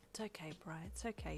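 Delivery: very soft, gentle soothing. A narrator's reading. Uncle consoling his sobbing boyfriend, gently. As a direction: comfort him softly. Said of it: "he said gently".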